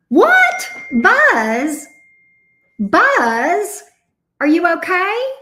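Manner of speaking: high, loud, alarmed questions; startled concern. Real, not acted. Host checking on Buzz mid-stream, startled and loud. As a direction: call out in real startled alarm.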